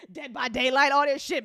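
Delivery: laugh, then say it loud through the laugh; amused, laughing exclamation. Real, not acted. Laughing at something read.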